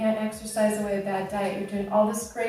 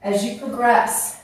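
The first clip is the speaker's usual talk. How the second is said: usual speaking voice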